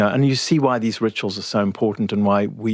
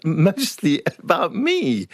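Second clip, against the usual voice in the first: laughing through the words, higher and slower; half-laughing, pitched higher; 9 semitones higher, slower than usual. Real, not acted. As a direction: giggle it out, amused at herself. Laughing at mum's rude remarks.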